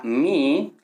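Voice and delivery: "mi" con la i alargada; sostén la vocal de "mi"; i larga. Shown as a demonstration. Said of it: "la i, es larga". ("mi" lento, estirando la i)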